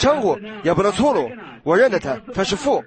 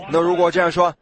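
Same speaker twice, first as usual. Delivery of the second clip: normal talking voice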